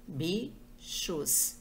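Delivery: "bichos" stretched out slowly as bi-chos; "bichos" spoken syllable by syllable, pausing between bi and chos. Shown as a demonstration. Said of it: "falando a palavra devagar".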